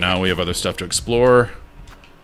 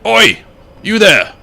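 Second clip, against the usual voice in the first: loud, high character call; acted shout in a put-on accent; hailing in character; 13 semitones higher, 8 dB louder, slower than usual. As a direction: call out sharply as a grumpy game character. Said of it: "what accent I'm doing for this guy".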